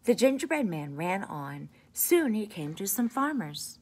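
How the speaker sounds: plain reading voice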